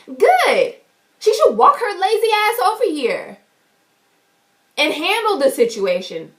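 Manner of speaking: high, mildly mocking indignation; indignant retort. Retold retort about the manager.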